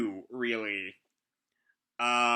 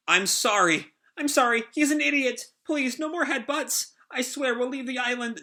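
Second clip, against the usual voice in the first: high, grovelling apology; mock-cowering plea, pitch climbing; Nami; 10 semitones higher than usual. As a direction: beg and placate like a cornered coward. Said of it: "Nami is just kinda kowtowing".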